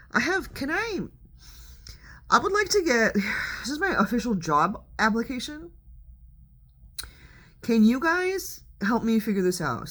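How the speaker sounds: squeaky high begging; high pleading voice. Real, not acted. Pleading job application bit.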